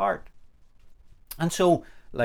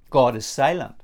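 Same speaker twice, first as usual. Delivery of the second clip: ordinary delivery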